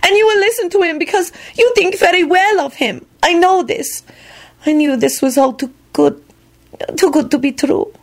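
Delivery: high, despairing lament; distressed, starting very high; wailing. A narrator's reading. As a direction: wail on, easing down in pitch. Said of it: "Cosmina wailed".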